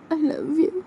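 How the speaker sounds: high voiced and rising; girl voice saying I love you; tender confession.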